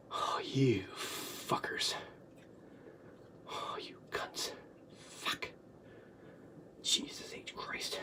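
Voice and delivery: slow, very soft whispered swearing; whispered curses with long pauses; stunned cursing. Real, not acted. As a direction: curse under your breath, drained. Cursing after a horrible memory.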